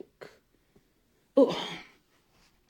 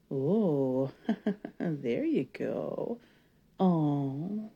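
ordinary delivery

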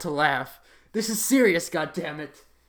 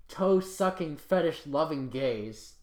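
usual speaking voice

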